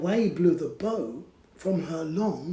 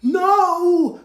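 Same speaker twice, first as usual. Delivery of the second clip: much higher, louder frightened cry; witch cries no; 13 semitones higher, 11 dB louder than usual. Acted. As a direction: scream no in panic. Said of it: "cried the witch".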